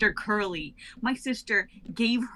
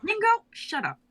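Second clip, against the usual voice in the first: much higher, snapping order; sharp high command; 4 dB louder than usual. Acted. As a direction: cut him off. Bia silences Ringo.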